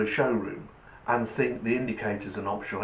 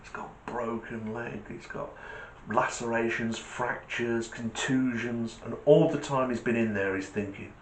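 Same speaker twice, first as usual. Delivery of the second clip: normal talking voice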